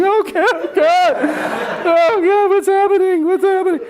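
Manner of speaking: high, loud shrill-free panicked wail; hammed-up panic as he climbs into the getaway car; mock panic.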